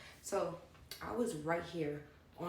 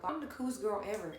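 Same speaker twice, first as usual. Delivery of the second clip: ordinary delivery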